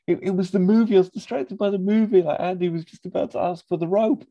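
high whiny Sean. Retold or imitated. Sean blaming the movie to Liz, whining. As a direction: whine the excuse. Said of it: "And he goes".

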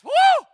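high loud woo; excited whoop. Real, not acted. Pastor whooping with the crowd, joyful loud whoop. Preacher's exuberant cry.

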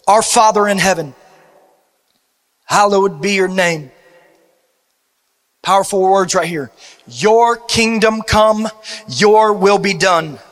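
prayer. Acted. Reciting the Lord's Prayer.

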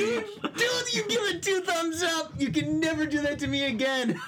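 high, gleeful crowing shout; triumphant gloating. Real, not acted. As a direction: crow over the win, laughing. Triumphant gloating over fooled friend.

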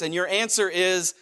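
ordinary delivery

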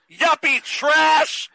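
high, drawn-out mock yell; hammed-up heckling shout; yelled movie insult. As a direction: yell it long and high, hamming it up.

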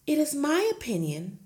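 lightly voiced gentle teacher; a hint of the meek young teacher; Miss Honey pleading. Teacher protesting to her headmistress, lightly voiced. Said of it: "Miss Honey said".

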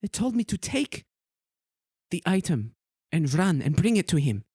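put-on high, fast young woman; tiefling admitting the message in her head; eager tiefling. Tiefling NPC answers.

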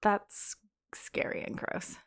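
whispered, slow, disgusted. Real, not acted. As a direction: breathe it out in disgust. Disgusted reaction to dolphin births.

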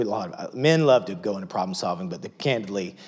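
normal talking voice

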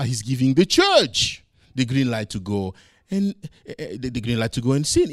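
lightly voiced doubting churchgoer; a hint of a sceptical listener; voicing the congregation's objection; mock objector. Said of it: "some of you may think now".

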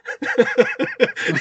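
high breathy burst of laughter. Real, not acted. Teller laughing at his own story, high laughter. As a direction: laugh helplessly at the memory.

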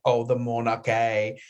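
imitating sister's British accent, drawn out; slow, playful British accent; mock British accent. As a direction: put on a posh-royals British accent. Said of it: "just talk in a British accent".

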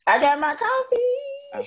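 high, slow sing-song reply; playful sing-song. Real, not acted. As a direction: sing it out proudly. Playful reply about coffee.

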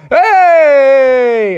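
loud, high shouted greeting; group cheer. Acted. Founding fathers loudly greet the visitor at the door. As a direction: call out a big welcome.

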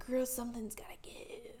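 hushed whisper to herself; whispered self-talk. Retold or imitated. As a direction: whisper it like a private pep talk. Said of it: "I was like".

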